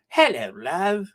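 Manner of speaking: put-on cheery hello in playful East End accent. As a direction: put on your best London East End. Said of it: "That's my best East End accent".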